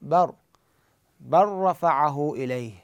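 barr-rafa'ahu ilayh with the l swallowed into r; no lam, doubled ra. Shown as a demonstration. Teacher repeating the example without lam, ra doubled. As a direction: slowly stress the merged r in barr. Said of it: "بدون لام".

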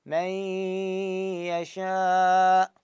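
من يشاء with a long nasal ghunna, very slow; ghunna held long. Shown as a demonstration. Teacher stressing the held ghunna. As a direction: lean on the nasal hum of من for two counts. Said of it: "مو رأسًا أقول".

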